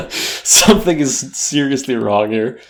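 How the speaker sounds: usual speaking voice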